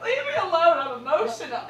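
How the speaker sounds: high, loud hammed-up plea; mock whiny protest. Crying daughter answers her dad, whiny protest. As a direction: wail it like an overwrought kid. Child snapping back at dad.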